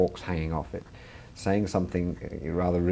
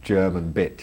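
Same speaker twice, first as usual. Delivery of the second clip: usual speaking voice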